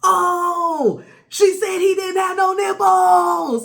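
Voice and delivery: high, loud, drawn-out fake bawling; exaggerated tearful holler; mock wail. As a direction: wail it out like a hammed-up crybaby. Mock wailing re-enactment of girlfriend.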